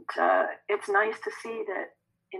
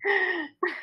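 higher voice breaking into laughter; laughing; 10 semitones higher than usual. Real, not acted. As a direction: say it through helpless chuckles. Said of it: "I'm sorry to laugh".

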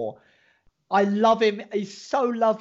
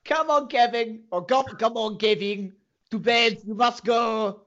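raised, hammed-up French accent; bad French accent; 4 semitones higher, 3 dB louder than usual. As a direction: coax someone along in a mangled French accent. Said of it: "do a French accent".

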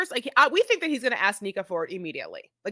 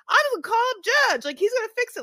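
put-on much higher playful girl voice; mock girly; 11 semitones higher, 4 dB louder, faster than usual. Host mimicking the girlfriend talking about her judge friend, much higher. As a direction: chirp it like a carefree friend. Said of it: "the way that she says judge".

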